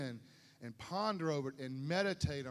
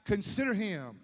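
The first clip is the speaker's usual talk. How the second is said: loud, insistent climax of the sermon; loud, passionate pulpit delivery; fervent preaching; 4 semitones higher, 7 dB louder than usual. Acted. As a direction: hammer the point home to the church.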